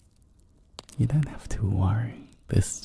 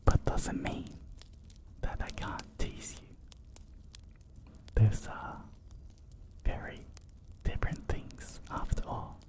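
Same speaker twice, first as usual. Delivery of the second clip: very soft, close whisper; playful, hushed whispered teasing; 3 semitones higher, 13 dB quieter than usual. Acted. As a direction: whisper slyly into the listener's ear. Teasing listeners about surround sound.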